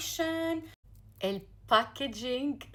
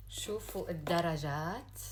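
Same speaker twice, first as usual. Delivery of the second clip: usual speaking voice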